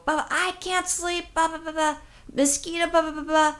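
mock screaming rant. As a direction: imitate a sleepless rant in a high voice. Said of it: "screaming at me".

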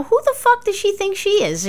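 hammed-up indignation; indignant thought. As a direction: scoff at her nerve. Retold angry inner thought.

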